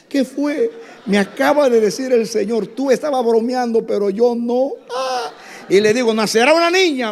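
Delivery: high, loud excited storytelling; excited climax. Retold or imitated. Preacher tells the congregation the miracle, high and excited. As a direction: build the story to its peak.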